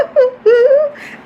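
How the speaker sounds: sudden high laughing burst; burst of laughter. Real, not acted. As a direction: let a big high laugh escape. Laughing at messy sink.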